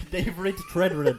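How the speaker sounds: drawn out slowly through laughter; laughing. Real, not acted. Laughing as he repeats his favourite actor's name. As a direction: savour the name, giggling.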